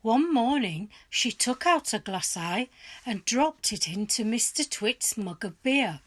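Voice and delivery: plain reading voice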